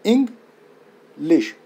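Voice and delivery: English slowly broken as इंग, pause, लिश; split into syllables. Shown as a demonstration. Teacher showing the two syllables in English, English split slowly in two. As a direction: separate both syllables of English with a clear gap. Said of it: "दो syllables पाए जा रहे हैं".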